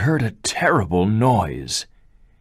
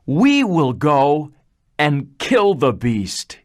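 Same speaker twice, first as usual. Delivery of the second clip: determined vow; 7 semitones higher, 3 dB louder, slower than usual. Acted. Brothers vowing to kill beast.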